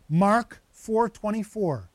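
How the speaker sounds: slow dictation. Shown as a demonstration. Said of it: "I'll give those to you again".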